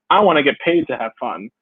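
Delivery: usual speaking voice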